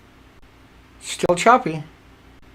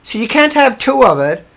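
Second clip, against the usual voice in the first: ordinary talk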